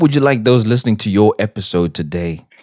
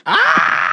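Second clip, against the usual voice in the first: delighted shriek and laugh. Real, not acted. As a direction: squeal with delight, then laugh. Reaction to the lesson.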